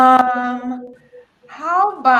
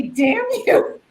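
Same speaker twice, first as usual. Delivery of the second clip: mock quote of a friend's curse, higher; imitating Anna; 10 semitones higher than usual. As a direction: parrot her curse back in a teasing high voice. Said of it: "just to hear you say".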